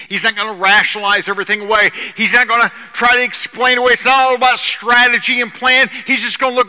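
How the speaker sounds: loud, fast, rising sermon drive; preaching at full force. Real, not acted. Preacher urging congregation, loud and driving. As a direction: build the fire, push through.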